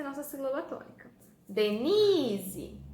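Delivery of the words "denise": "Denise" called loudly, NI stretched long; calling the word. Shown as a demonstration. Teacher calling Denise to find the stress, long loud NI in "Denise". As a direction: call out "Denise", lingering on a loud NI. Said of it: "Qual que eu fiquei mais tempo falando?".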